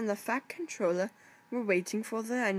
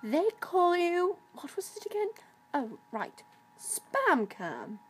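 high, mocking taunt; teasing; 10 semitones higher than usual. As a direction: poke fun at the newcomer. Caitlin mocks the diesel.

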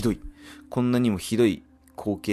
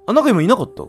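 high, loud startled question; 12 semitones higher, 9 dB louder, much faster than usual. Real, not acted. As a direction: jump at a shape. Startled by something moving.

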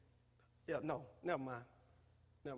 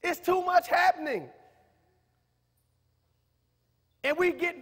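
preaching at full force, louder, rising to shouts; 6 semitones higher, 8 dB louder than usual. Acted. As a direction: rouse the congregation, building to a cry. Preacher exhorting congregation.

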